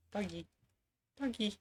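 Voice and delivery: high, soft dog-calling voice; coaxing pet talk. Real, not acted. Streamer coaxing the dog to camera, high and soft. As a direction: coo softly up high to a shy dog.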